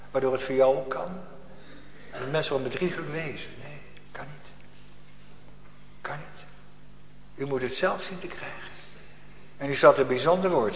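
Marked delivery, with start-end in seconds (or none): 3.6-6.4 very soft, firm denial; quiet refusal. Acted. (zacht maar beslist)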